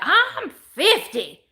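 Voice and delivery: high, slow, put-on proud voice; SNL "I'm fifty" bit. Host quoting an SNL sketch about her age, high slow mock pride. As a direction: stretch it out like the sketch lady. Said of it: "You know that Saturday Night Live thing?".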